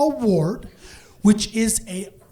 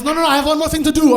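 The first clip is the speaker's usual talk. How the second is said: high, loud, hurried insistence; insistent refusal; 7 semitones higher, 6 dB louder, much faster than usual. Acted. Mom refuses her son's plea to leave, rapidly insistent. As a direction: brush off the objection in a rush.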